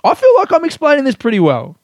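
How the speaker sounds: high, loud burst of protest; mock defensive shout. Podcaster defending their poetry explanation, mock defensive shout. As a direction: blurt it out in hammed-up defensiveness.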